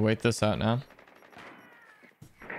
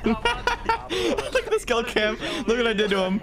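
laughing while talking; 9 semitones higher than usual. Real, not acted. Laughing at skull cam.

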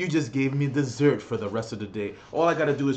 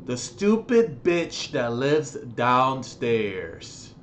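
ordinary talk, nothing marked

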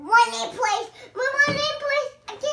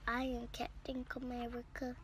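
fast unvoiced whisper; 6 semitones lower, 16 dB quieter, faster than usual. Real, not acted. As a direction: whisper it quickly. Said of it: "stop whispering".

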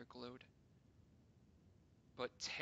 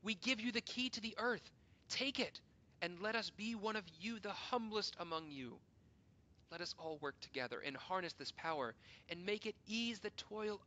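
high, loud impassioned appeal; fervent cry; 6 semitones higher, 7 dB louder than usual. Acted. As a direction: urge them on with rising passion. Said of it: "we cried".

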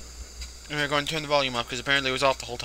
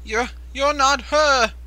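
horrified; 9 semitones higher, 7 dB louder, slower than usual. Real, not acted. Realises figure is fake.

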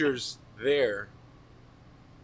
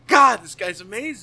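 loud, higher awed exclamation; 7 semitones higher, 11 dB louder than usual. Retold or imitated. Student in awe of teacher, loud and amazed. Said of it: "And I was like".

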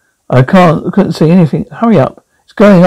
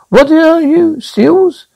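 dummy voice; 10 semitones higher, much slower than usual. Acted. Dummy heckling the audience.

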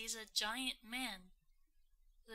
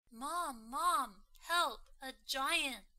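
panicked call for help; 3 semitones higher, 6 dB louder, slower than usual. Acted. Jack fleeing the giant.

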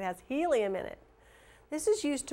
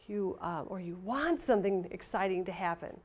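usual speaking voice

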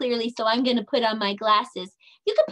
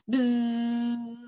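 bee buzz. Acted. Bee buzzing sound.

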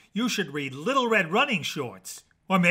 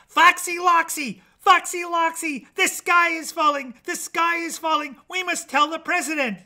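high, loud frantic chicken; shrill-pitched barnyard panic; yelling hen; 11 semitones higher, 6 dB louder than usual. A narrator's reading. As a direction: yell the alarm like a panicked hen. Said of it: "yelled Chicken Licken".

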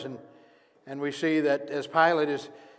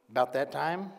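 ordinary talk

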